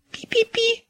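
very high squeaky peeping; peeping baby chick. A narrator's reading. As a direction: squeak like a tiny mouse. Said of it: "запищал мышонок". (мышиный писк)

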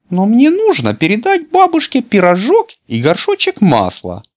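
put-on very high, loud girlish protest; a small girl fretting about her errand; little girl voice. A narrator's reading. Girl objects to Petya. (девочка возражает Пете, высоким тонким голоском)

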